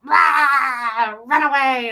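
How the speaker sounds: a loud, high mock roar, then a shout; monster roar. Lestat and Gabrielle scaring the churchgoers, loud mock roar. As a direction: roar at them like a devil bursting from a coffin. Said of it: "rushing out, like".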